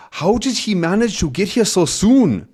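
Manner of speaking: a hint of a German crook asking in surprise; German accent. Crook asking his partner, light German accent. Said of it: "he's asking in his German accent".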